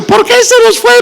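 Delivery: very high-pitched, hammed-up wail; mock wailing, sustained way up high; wailing funeral mourner. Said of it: "ella estaba llorando y dice".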